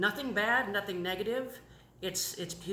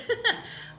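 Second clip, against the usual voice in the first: laugh; 6 dB louder than usual. Real, not acted. Emotional testimony, laughing through tears.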